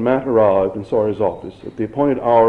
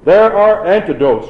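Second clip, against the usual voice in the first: loud, high cry over applause; high, forceful public shout; 7 semitones higher, 7 dB louder than usual. A narrator's reading. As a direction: raise the voice to carry over a noisy crowd. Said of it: "she shouted".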